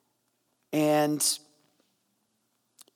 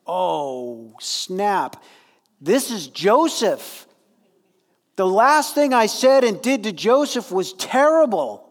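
mock alarm; 4 semitones higher, 4 dB louder, slower than usual. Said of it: "They're like".

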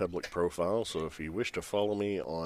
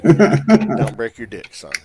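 mock warning; 13 dB louder, slower than usual. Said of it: "As Sam said".

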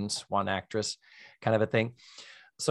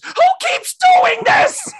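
very high, loud, slow squawk; mock shriek; 9 dB louder, much slower than usual. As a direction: stretch out a shrieking complaint.

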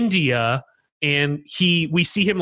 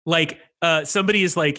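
usual speaking voice

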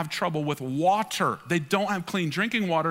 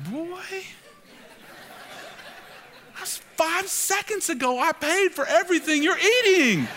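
mock exasperated dad; 12 semitones higher, slower than usual. Pastor reenacting begging his son for a single fry. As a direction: whine for one fry like a wronged father.

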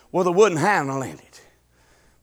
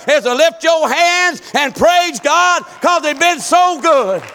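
higher and louder, shouting the key lines; preaching at full force; 7 semitones higher, 5 dB louder than usual. Acted. Preacher urging the congregation to store up praise, fiery preaching. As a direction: drive it home like a revival preacher.

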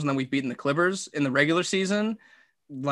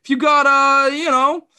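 Kevin Harlan ordering; 11 semitones higher than usual. Pretend. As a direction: order a table like you're calling a game. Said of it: "you're Kevin Harlan".

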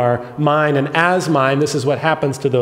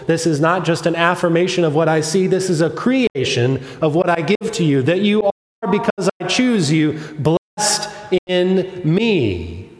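raised, building preacher's voice, slowing at the end; preaching God's words; 5 semitones higher than usual. Acted. Pastor speaking God's blessing over his people, rising. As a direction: proclaim it as God's own promise.

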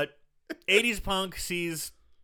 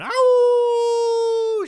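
long, high, loud howl; imitated wolf howl; 12 dB louder than usual. Retelling the American girl's signal to the pack, howling. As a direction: howl like a wolf, held out on one high note. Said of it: "She's howling".

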